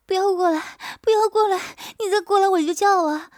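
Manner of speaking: high, loud frightened shouting; wife backs away crying out, high and loud; panicked mock cry. A narrator's reading. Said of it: "摇着头叫道".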